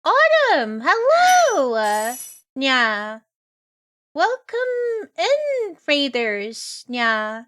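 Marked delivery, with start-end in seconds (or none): 0.0-1.7 high sing-song greeting; cute anime streamer. Real, not acted. 4.1-5.8 slow, high sing-song welcome; cute anime streamer. Real, not acted. Greeting raiders, drawn out.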